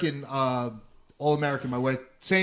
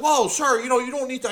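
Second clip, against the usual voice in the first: high-pitched mock protest; imitated alarmed salesman; 9 semitones higher than usual. Salesman backs off from threatening customer, imitated alarm. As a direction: jump up high, placating an angry customer.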